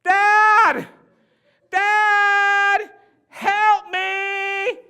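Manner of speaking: high, loud, urgent yell for help; daughter yelling for help. Retold or imitated. Daughter's unmistakable cry to her father: high loud yell. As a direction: yell for Dad as loud as you can.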